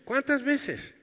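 a high, pressing rhetorical question; emphatic question. Real, not acted. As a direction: lift it high to hammer the point.

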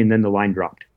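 usual speaking voice

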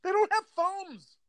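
very high, drawn-out objection; exasperated, voice way up and slow; incredulous protest. Real, not acted. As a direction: stretch it out in high-pitched disbelief. Exasperated objection in playful argument.

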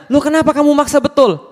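high-pitched, incredulous challenge; exasperated questioning. Acted. Younger man asking why grandpa insists, exasperated and high. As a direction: question the stubborn elder, pitch well up.

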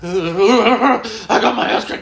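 melting down in a panic attack; wailing. Retold or imitated. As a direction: drag it out in a blubbering cry.

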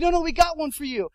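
high, loud, eager reassurance; pushy helper jumping in; imitated insistent guide. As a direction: brush off the refusal eagerly.

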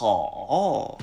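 好 stretched very long; drawn-out 好. Shown as a demonstration. Teacher practises again with learner, 好 held long. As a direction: sustain 好 in one slow bend. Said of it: "この最初の 好 をこう伸びる、 伸ばすんですよ".

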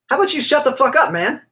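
high, snapping retort; mock angry retort. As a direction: toss it off like a fed-up player. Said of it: "Nick Kyrgios says on international television".

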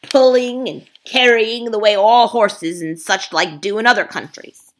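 loud, pushy ape lecturing the beasts; a sly ape bullying the crowd; scheming ape. Acted. Ape haranguing the gathered beasts, loud and pushy.

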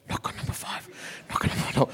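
very soft whispered repeated urging; whispered inner voice. Retold or imitated. Inner voice urging him on the street, whispered. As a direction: whisper it like a voice inside your head.

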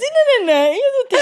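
high, loud laughing protest. Real, not acted. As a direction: laugh through the words. Laughing through retold protest.

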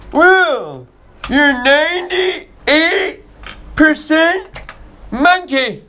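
high, slow mock-pompous voice; mocking the scientists. Dad mocking scientists for his kids, high and slow. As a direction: mimic them as pompous and silly. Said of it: "Now they wanna say".

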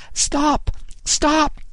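high-pitched cry over the wind; urgent call. A narrator's reading. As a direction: call out in alarm over rushing air. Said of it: "Sexton called over the rush of air".

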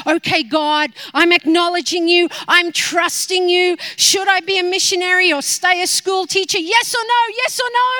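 insist, almost shouting; pleading demand. Retold or imitated. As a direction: she demands a straight answer from God, loud and pleading. Demanding answer from God.

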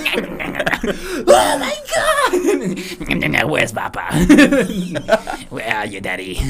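put-on high, loud panicky baby, laughing; newborn baby voice. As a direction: squeal it like a baby.

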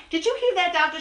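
normal talking voice

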